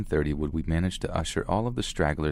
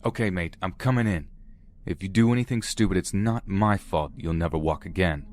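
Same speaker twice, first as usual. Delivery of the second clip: plain reading voice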